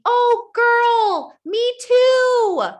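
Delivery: high, loud gushing; gushing friend. Retold or imitated. Commenter gushing that she feels the same way. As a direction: squeal it to a close friend. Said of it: "comments saying".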